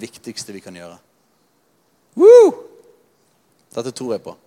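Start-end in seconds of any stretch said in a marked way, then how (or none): 2.2-2.5 high, loud joyful whoop; sudden whoop from the pulpit; excited whoop. Real, not acted. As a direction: let out a big cheer of joy. Preacher's excited shout.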